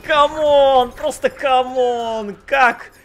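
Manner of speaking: high, loud disbelieving cries; exasperated shouting. Real, not acted. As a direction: stretch out the exasperated come on. Outrage at a comeback.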